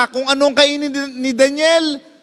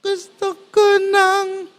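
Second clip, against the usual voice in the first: Gusto ko nang lifted much higher and slowed; raised higher; 10 semitones higher, slower than usual. Shown as a demonstration. Pastor redoing the plea higher as he told it, slow and raised. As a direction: pitch Gusto ko nang up high, drawn out. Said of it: "itaas mo ng konti".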